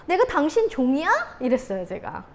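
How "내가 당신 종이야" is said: high, loud indignant outburst; retold blow-up. Wife exploding at her husband, indignant retold outburst. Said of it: "터졌을 때 어떻게 터졌냐면".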